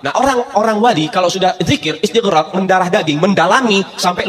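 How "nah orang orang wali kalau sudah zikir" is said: normal talking voice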